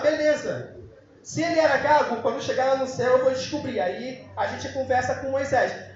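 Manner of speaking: normal talking voice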